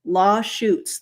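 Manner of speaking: "lawsuits" repeated as "law-shoots"; mimicked slip. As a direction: say "lawsuits" with SH replacing the S. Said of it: "that's a new one".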